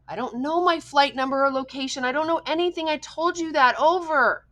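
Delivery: frustrated reply. A narrator's reading. Boy frustrated on radio.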